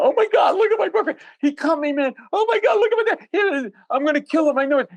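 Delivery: put-on very high, panicked shrieking girlfriend; girlfriend crying out. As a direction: shriek in hysterical panic over the boyfriend. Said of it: "The girlfriend of the guy's crying out".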